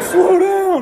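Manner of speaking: high, loud alarmed cry; panicked yell. Retold or imitated. Sober passenger begs drunk driver to stop speeding, frightened high yell. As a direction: yell it as the car flies down the hill.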